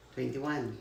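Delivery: normal talking voice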